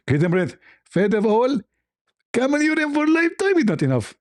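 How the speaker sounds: haggling in a squeaky caricature voice; hammed-up high-pitched demand; Zakir Naik. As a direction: climb high in mock indignation.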